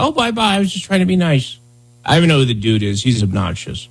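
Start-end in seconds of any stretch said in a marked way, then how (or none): 0.0-1.6 higher-pitched mock innocence; quick, high mock-nice voice; mocking imitation. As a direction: mimic a feeble, hurried excuse. Mockingly acting out the other person.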